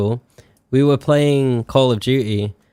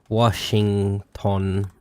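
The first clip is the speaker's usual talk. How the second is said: slow spelling out; 2 semitones lower, much slower than usual. Real, not acted. Spelling state names.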